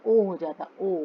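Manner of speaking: ओ with rounded lips; clear, round "oh" on ओ. Shown as a demonstration. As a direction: make the mouth a circle for ओ. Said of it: "गोल हो जाएगा मुंह".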